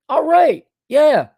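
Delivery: retold reaction. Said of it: "And I'm like".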